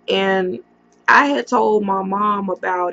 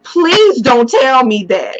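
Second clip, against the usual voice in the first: slow, loud begging, voice raised; desperate loud plea; 4 semitones higher, 8 dB louder than usual. Retold or imitated. Retelling how she begged the boyfriend's mother on the phone, slow loud desperate plea. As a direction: beg her to take it back. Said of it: "I screamed".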